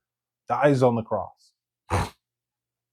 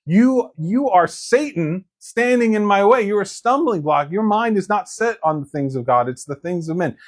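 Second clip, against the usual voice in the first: stern rebuke; 7 semitones higher than usual. Retold or imitated. Preacher voices Jesus rebuking Peter.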